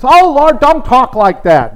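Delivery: high and loud, a protesting cry to the Lord; Peter's alarmed protest. Retold or imitated. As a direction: play Peter crying out in alarm, held back. Peter pleading with Jesus, retold.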